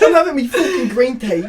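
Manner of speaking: high, loud mock-Cockney outrage; hammed-up affronted Cockney; Cockney accent. Teacher disturbed over green tea, loud mock-Cockney accent. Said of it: "it's like a Cockney accent".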